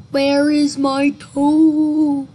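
loud, drawn-out ghostly groan; big slow haunting moan. A narrator's reading. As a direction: groan it out slowly, holding the last word. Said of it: "it groaned".

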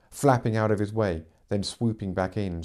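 usual speaking voice

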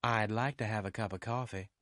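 lightly voiced young woman; cheered-up office girl. A narrator's reading. Susie accepts the detective's offer of coffee, lightly pleased. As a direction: accept the invitation in a girl's voice.

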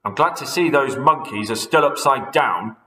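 smug satisfied gloat; Mister Twit gloating to his wife. Acted. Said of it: "said Mister Twit".

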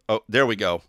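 ordinary talk, nothing marked

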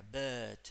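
"Bird" on a held central E, no R; long E sound. Shown as a demonstration. Teacher repeats the model, "Bird" with long E sound. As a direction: lengthen the vowel of "Bird", R silent. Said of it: "Ese es el sonido de la E larga".

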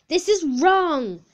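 loud, slow, high complaint; dramatic, stretched-out objection; indignant protest. Real, not acted. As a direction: draw it out loudly in outrage. Protests the quiz result.

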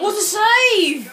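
high, stretched-out mock cheer; teasing catchphrase. Friend needles the commentator, mock cheering. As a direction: crow it high, dragging out the save.

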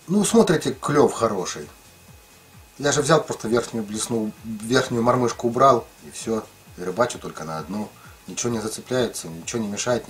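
ordinary delivery

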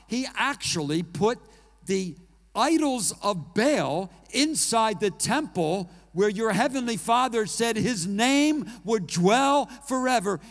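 loud, rising sermon delivery that builds and builds; full force preaching. Acted. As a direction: thunder it out from the pulpit.

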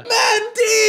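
high, shouted cry of the name; piercing call to the driver; excited shout. Retold or imitated. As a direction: yell it out in glee.

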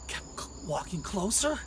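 plain reading voice

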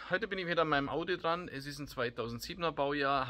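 normal talking voice

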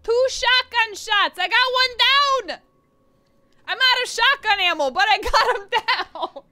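loud, high, frantic play-by-play; frantic excitement. Real, not acted. Gamer blasting enemies, loud and high-pitched. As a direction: yell it in the heat of the fight.

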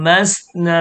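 "มัส นะ" with air blown through the s; breathed seen sound. Shown as a demonstration. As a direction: let the s of "มัส" hiss before "นะ". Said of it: "ตัว ซีน พ่นลม".

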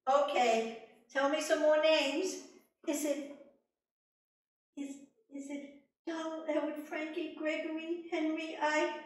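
high, loud, rushed list of names; queen listing names to the little man; eager name guessing. Acted. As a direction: rattle off the names.

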